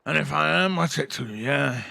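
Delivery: loud, defiant challenge; belligerent old drunk. Acted. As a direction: throw it back at the stranger, loudly.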